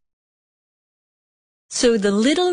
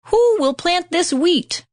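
high, eager hen's question; hen crying out; 10 semitones higher than usual. A narrator's reading. Hen asking the lazy animals for help, high call. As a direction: call out brightly as the little hen. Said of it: "cried the little red hen".

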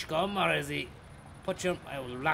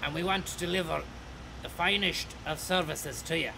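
company announcement; 2 semitones higher than usual. Acted. Driver announcing the company slogan to passengers. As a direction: sell the bus company like a radio ad.

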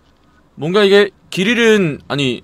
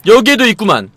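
ordinary talk, nothing marked

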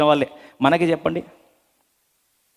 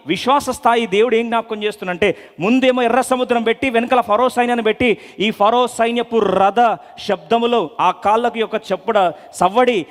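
raised, driving sermon voice; preaching at full force; 8 semitones higher than usual. Acted. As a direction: preach it with rising urgency.

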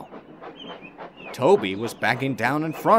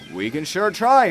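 high, willing driver voice; game driver; 8 semitones higher, 5 dB louder than usual. Acted. Toby's driver accepting the job, high and willing. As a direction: agree cheerfully.

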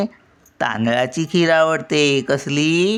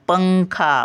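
"पंखा," with a lifted, held "पं", then a drop; पं उचलून; 4 semitones higher, slower than usual. Shown as a demonstration. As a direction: lean on the nasal first syllable of "पंखा,". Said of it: "पला उचलून धरतो". ("पंखा," मधला पं उचलून धरा)

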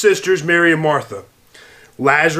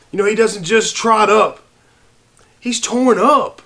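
higher, building passion in the sermon; rising preaching; 5 semitones higher than usual. Acted. Preacher tells the congregation of Jesus at the tomb, building fervor. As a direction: preach it, swelling with feeling.

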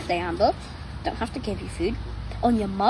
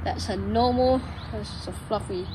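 ordinary delivery

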